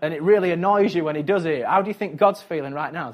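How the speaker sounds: ordinary talk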